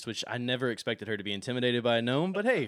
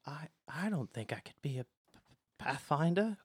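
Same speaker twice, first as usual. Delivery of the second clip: soft, stammering self-doubt; meek, hesitant stammer; nervous, scared underling; 3 semitones higher, 4 dB quieter than usual. Acted. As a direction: stutter timidly on the p, rising at the end. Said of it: "he's so nervous and".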